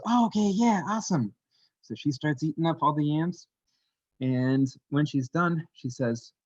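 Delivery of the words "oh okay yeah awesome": high, bright delighted yes; delighted fairy. Acted. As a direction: squeal it like a thrilled little fairy.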